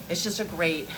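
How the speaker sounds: ordinary talk, nothing marked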